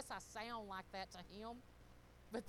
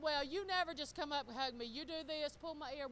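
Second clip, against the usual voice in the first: retold complaint; 5 semitones higher, 6 dB louder than usual. Retells her complaint back to husband.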